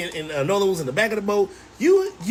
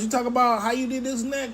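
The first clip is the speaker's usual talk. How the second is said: normal talking voice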